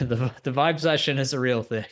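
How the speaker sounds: breaking into laughter; laughing. Real, not acted. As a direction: try to stay straight and crack up. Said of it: "you can't even say it with a straight face".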